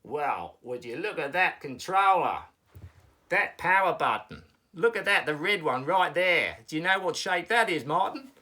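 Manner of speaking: loud, eager Aussie puppet; Australian accent. Pretend. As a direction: build excitement in broad Australian. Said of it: "you're going with the Australian accent".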